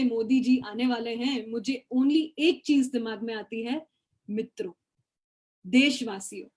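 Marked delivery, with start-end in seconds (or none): 4.3-6.5 Modi's tone. Retold or imitated. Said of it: "उसी tone में ... मोदी जी बोलते हैं".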